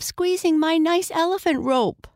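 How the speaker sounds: put-on high-pitched pleading little boy; crying out. A narrator's reading. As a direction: protest high, like an upset child. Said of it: "cried Archie".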